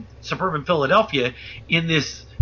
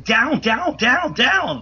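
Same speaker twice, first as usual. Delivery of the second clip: higher, mock shout at barely raised volume; retold yelled command; 7 semitones higher, much slower than usual. Police order everybody to the ground, urgent mock yell. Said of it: "they start yelling".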